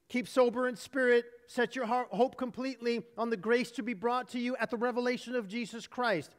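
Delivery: ordinary talk, nothing marked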